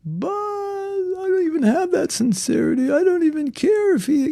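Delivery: hammed-up voice of a devotee sure he isn't sincere; whiny self-doubter. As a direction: squeak out the doubts like a self-pitying mind. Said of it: "go down this endless cycle".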